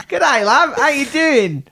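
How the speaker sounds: high-pitched mock Australian; Australian accent. Shown as a demonstration. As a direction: greet in a put-on Aussie accent. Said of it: "what would Australian be like".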